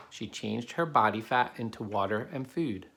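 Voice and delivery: ordinary delivery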